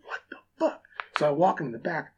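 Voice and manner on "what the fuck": a breathless, voiceless whisper; whispered disbelief. Retold or imitated. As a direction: hiss it so no one hears. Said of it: "I'm like".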